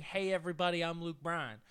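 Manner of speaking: soft, lightly voiced country greeting. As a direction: mumble the catchphrase. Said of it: "I can't stop saying".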